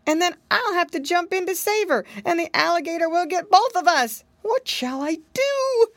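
high, anxious fretting little pig; worried piggy boy. Acted. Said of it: "thought Curly Tail".

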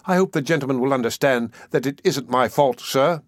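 plain reading voice

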